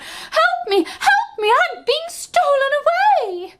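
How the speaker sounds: very high, loud cry for help. A narrator's reading. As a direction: shriek for help as the stolen harp. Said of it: "she began to cry out".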